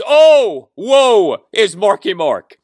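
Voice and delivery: hammed-up pity, high, loud and drawn out slowly; sarcastic wail of false sympathy for the departed coach; mock lament. Host mocking pundits' pity for Turgeon, sarcastic wail.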